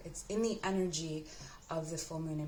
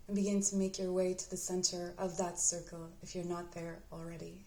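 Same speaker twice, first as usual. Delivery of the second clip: unhurried, even-toned meditation guide; guided meditation. Acted. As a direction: guide the listener gently inward, leaving space to breathe.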